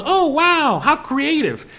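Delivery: a hint of a fake-impressed influencer; mock TikToker gush. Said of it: "She goes".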